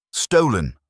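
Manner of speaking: loud high desperate outcry; desperate cry. A narrator's reading. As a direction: cry out in desperation, accused and cornered. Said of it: "Thornbury cried desperately".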